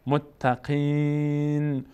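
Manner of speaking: متقین with long drawn-out ī, ending on a vowelless n; stopping on silent nun. Shown as a demonstration. Teacher showing a verse ending, drawn-out pausal reading. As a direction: recite متقین very slowly, closing on a bare nun.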